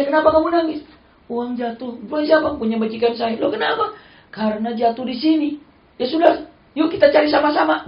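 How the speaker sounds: high, loud storyteller voices; acted dialogue. Preacher acting the Prophet and the crying slave, high. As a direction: act out both sides eagerly.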